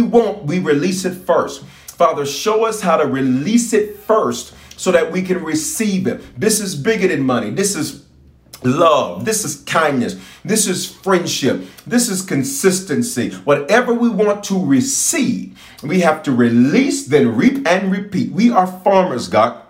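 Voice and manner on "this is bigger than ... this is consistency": ordinary talk, nothing marked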